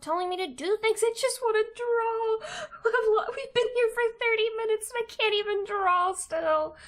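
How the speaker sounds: high, loud frustrated whining yell; real frustrated wail; exasperated shout. Real, not acted. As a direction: yell at the screen in despair. Frustrated streamer yelling at software.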